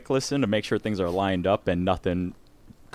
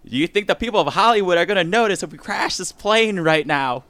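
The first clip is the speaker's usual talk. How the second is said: higher, hammed-up transatlantic accent like old Katharine Hepburn; 7 semitones higher, 3 dB louder than usual. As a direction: play a grand old-Hollywood transatlantic lady. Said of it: "The transatlantic accent".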